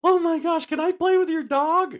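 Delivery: loud, gushing falsetto; the high dog voice. Acted. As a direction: beg sweetly way up high. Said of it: "I did the voice".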